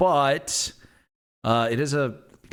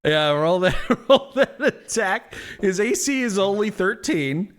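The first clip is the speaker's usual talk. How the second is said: laughing; 6 semitones higher than usual. Real, not acted. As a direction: laugh through the words. GM laughs urging attack.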